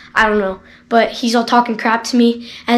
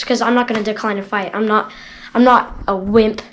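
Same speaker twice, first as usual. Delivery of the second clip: ordinary delivery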